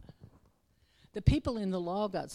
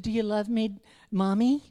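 put-on child asking; 7 semitones higher, 10 dB louder than usual. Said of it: "if your kid came to you and".

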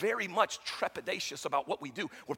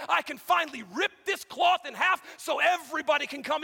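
starts level, swells higher and louder; building to a shout; 6 semitones higher, 5 dB louder than usual. Acted. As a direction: let God's voice swell to a roar. God voiced, rising to shout.

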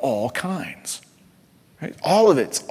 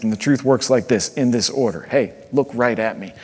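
ordinary delivery

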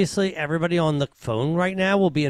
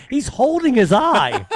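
usual speaking voice

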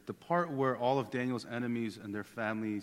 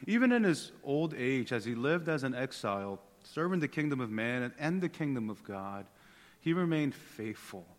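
ordinary talk, nothing marked

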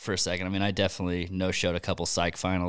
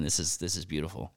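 ordinary talk

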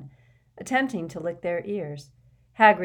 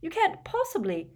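high-pitched, scandalised protest; appalled disbelief; shocked; 13 semitones higher than usual. A narrator's reading. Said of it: "sounding shocked".

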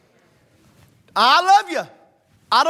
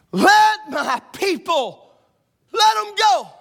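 slow preacher call, rising; resonant slow sermon; 5 semitones higher, 3 dB louder, slower than usual. Shown as a demonstration. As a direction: draw it out like a preacher. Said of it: "in your best preacher voice".

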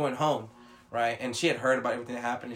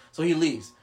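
ordinary talk, nothing marked

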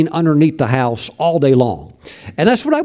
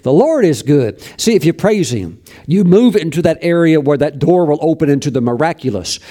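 preaching. Real, not acted. Preaching on praise.

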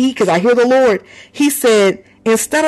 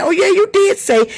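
sudden remembering; 11 semitones higher, 4 dB louder than usual. Retold or imitated. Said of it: "I'm like".

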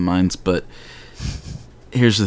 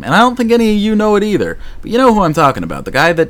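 high, loud and fast; excited rant; 13 semitones higher, 6 dB louder, faster than usual. Real, not acted. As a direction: rattle it off in a heated rush. Ranting to the internet.

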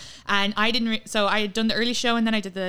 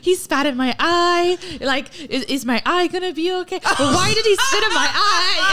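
higher, slow, half-laughing mock panic; panicked girl; 9 semitones higher, 4 dB louder than usual. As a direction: imitate a freaked-out girl, laughing through it. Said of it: "she's like".

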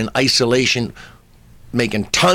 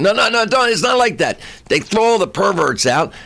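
higher-pitched, insistent retold booker; insistent persuading; 8 semitones higher than usual. Booker reassuring a comic about a strip joint, insistent persuading. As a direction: talk him round eagerly, pitched up.

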